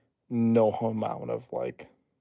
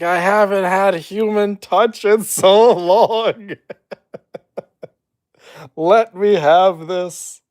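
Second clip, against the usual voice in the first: much louder, higher and slow, half laughing; desperate plea; 8 semitones higher, 10 dB louder, slower than usual. Pretend. Pleading with airport security, desperate and loud. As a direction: beg dramatically through laughter. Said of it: "You're like".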